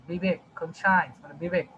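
ordinary talk

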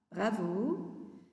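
ordinary delivery

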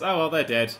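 ordinary talk, nothing marked

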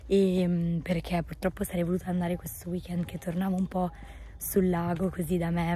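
ordinary delivery

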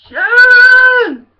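high, very loud drawn-out holler. Acted. Rock star calling his wife, long high yell. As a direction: bellow for your wife across the house.

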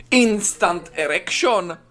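slow, high-pitched big reveal; mock announcement. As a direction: announce it grandly, drawing out each word. Mock announcement after build-up. (teatrální, protáhlé ohlášení)

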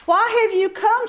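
high, pointed question; stern challenge. Acted. Sister of the invisible being questioning the proud girls, pointed. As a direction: challenge them at the door.

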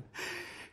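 soft, voiceless, menacing laugh; a cruel private chuckle; sinister breathy chuckle. Acted. The king chuckles at the mention of his torture chamber, menacing.

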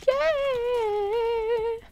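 high, long drawn-out squeal; celebrating. Real, not acted. Player celebrates a flower from Frina, high drawn squeal. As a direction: squeal a stretched-out cheer.

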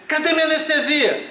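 mock demanding. Patient demands anesthesia from the nurse, high whiny demand. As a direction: whine for the missing anesthesia. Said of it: "você".

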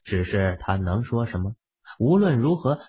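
ordinary delivery, nothing marked

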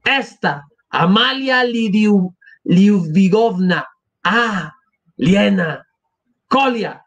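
slow names, each broken off by a pause; breath after each word. A narrator's reading. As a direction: call the names one at a time, pausing for breath. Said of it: "tomando aliento después de cada palabra". (palabras entrecortadas, tomando aliento tras cada una)

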